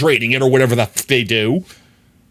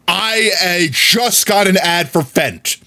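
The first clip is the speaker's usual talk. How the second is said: higher, slow, emphatic proclamation; full-caps announcement; 6 semitones higher, slower than usual. Retold or imitated. Streamer retelling his message in Juno's chat, emphatic full caps. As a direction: spell it out like typing in all caps. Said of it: "I full caps in Juno's chat".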